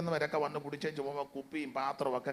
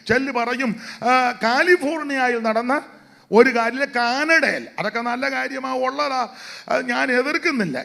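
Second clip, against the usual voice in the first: preaching at full force; 8 semitones higher, 9 dB louder than usual. Acted. Opening exhortation to the congregation.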